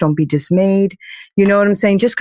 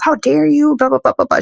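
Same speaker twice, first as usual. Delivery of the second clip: retold outrage; 8 semitones higher than usual. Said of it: "And she got so mad at me".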